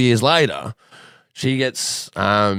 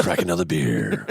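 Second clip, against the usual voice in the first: low radio host; 3 dB quieter than usual. Pretend. Continuing old man radio bit.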